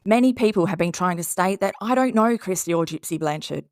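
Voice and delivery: ordinary talk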